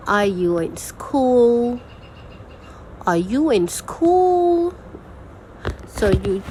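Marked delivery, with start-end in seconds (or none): 0.1-1.8 slow, "school" drawn out and lifted high; rising question tone. Shown as a demonstration. Teacher demonstrating a yes or no question, "school" rising. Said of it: "rising at the end of the sentence". 3.0-4.7 very high, sustained rise on "school"; rising question tone. Shown as a demonstration. Teacher repeating the example for students, exaggerated rise on "school". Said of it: "make sure you rise at the end".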